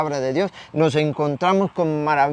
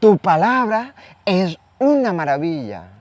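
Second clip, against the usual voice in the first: acted praise, pitched up; emotional acclamation; 5 semitones higher, 4 dB louder than usual. A woman in the crowd praising Jesus' word, emotional. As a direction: proclaim it with swelling emotion. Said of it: "¿De emoción?".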